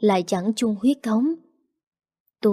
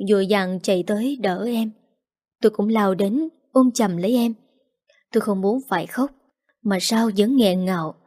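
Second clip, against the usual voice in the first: usual speaking voice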